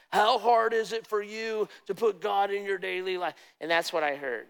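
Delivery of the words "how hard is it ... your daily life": disgusted scolding. Retold or imitated. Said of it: "I heard almost a disgust".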